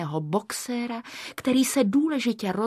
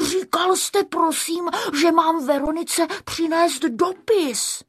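high, pleading little voice; timid Škubánek; 7 semitones higher than usual. A narrator's reading. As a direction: explain politely in a high voice. Zaražený Škubánek se brání. (vysoký omlouvavý hlásek)

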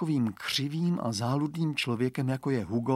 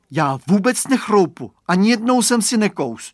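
put-on high, loud boyish denial; earnest swearing; 7 semitones higher, 7 dB louder than usual. A narrator's reading. Boy swearing to the girl he never bit, insistent. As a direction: insist on innocence, voice raised. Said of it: "dušuje se chlapeček".

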